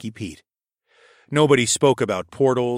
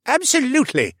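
much higher, louder eager agreement; chiming in; 5 dB louder than usual. A narrator's reading. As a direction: jump in eagerly. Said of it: "Roger chimed in".

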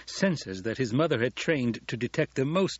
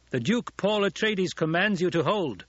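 high, raised proclamation of a duke; commanding shout; 8 semitones higher than usual. A narrator's reading. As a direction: call out an order over the fighting. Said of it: "Paul shouted".

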